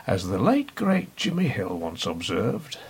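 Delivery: usual speaking voice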